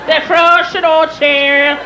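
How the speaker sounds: very slow Irish dictation; thick Irish brogue. Acted. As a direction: stretch each word in an Irish brogue while writing it down. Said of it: "He'd write it down".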